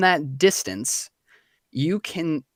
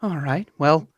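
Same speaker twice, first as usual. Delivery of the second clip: normal talking voice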